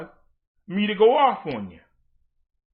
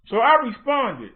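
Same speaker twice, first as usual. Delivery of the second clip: usual speaking voice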